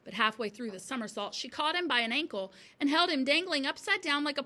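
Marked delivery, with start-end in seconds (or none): none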